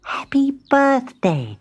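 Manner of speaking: high, breathy-free tiny whispered voice; tiny spider whispering a greeting; spider's whisper. A narrator's reading. Abigail the spider greets Timbertwig with a tiny high whisper. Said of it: "she announced".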